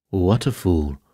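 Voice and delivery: ordinary delivery, nothing marked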